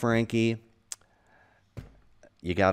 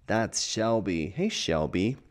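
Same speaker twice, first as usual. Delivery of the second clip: plain reading voice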